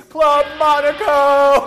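high loud yelled store name; screamed last words. Pretend. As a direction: scream it while falling. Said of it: "My final words would be".

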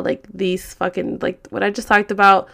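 usual speaking voice

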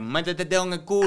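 loud, fast mocking heckle; a stranger's taunt shouted; heckler yelling from afar. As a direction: yell it from across the store.

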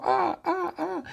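high, repeated squawks like a bird in flight; high imitated bird calls; bird cry. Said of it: "Ella vuela como un pájaro". (graznidos agudos de pájaro)

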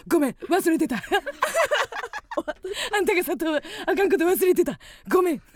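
laughing fake apology, loud; mocking laughing apology. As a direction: apologize through teasing laughter. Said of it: "煽りすぎやん". (笑いながら煽る謝罪)

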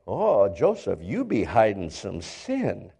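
lightly acted low, knowing accusation; a hint of a suspicious bystander; accusing onlooker. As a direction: drop low and soft to accuse. Acts out people accusing Joseph.

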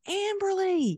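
high, bright call across a room; calling out a name. Retold or imitated. Imitating Jesse running in and calling her name high.

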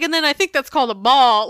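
imitating a clueless man explaining baseball; mock a bumbling mansplainer; clueless explainer. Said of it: "he's like".

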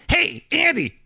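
high put-on caller voice; future-self prank voice. Prank calling Andy late at night as his future self, high put-on voice. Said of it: "Andy from the future".

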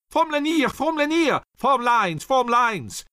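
high, loud, desperate rallying cry; German officer's frantic shout; shouted order. A narrator's reading. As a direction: yell for the men to form up. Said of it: "he shouted".

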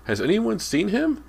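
very high, loud plea; shrill. A narrator's reading. Boy asking the whole kitchen, shrilly. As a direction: ask everyone in a shrill high voice. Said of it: "James repeated shrilly".

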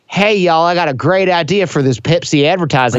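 playful put-on Southern accent; TV-learned Southern accent, lightly put on. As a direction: pitch an ad idea in a mock Southern accent. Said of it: "but yours isn't that bad".

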